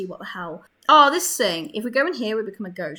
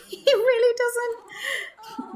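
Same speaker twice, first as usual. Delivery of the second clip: laughing; much slower than usual. Real, not acted. Laughing at bored sim.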